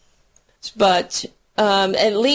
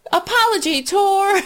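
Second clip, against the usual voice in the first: mock singsong; 13 semitones higher than usual. Recalling the kids' song.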